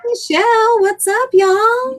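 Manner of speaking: high, excited hello; called-out greeting. Real, not acted. Co-host greeting listeners in a shout-out, high excited call.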